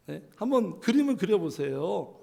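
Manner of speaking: ordinary delivery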